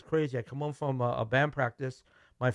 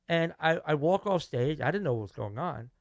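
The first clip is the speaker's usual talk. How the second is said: ordinary delivery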